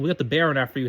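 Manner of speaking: plain reading voice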